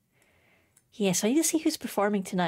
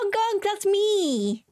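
high, slow goose honk; silly clown; 11 semitones higher, much slower than usual. Pretend. Excited goose imitation.

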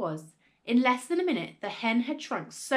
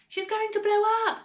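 very high, loud cry; panicked wail; 12 semitones higher, 6 dB louder than usual. A narrator's reading. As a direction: wail that disaster is coming. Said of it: "Missus Cranky wailed".

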